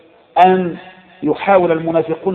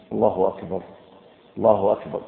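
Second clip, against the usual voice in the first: ordinary talk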